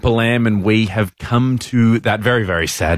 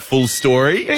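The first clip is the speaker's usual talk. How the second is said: ordinary talk, nothing marked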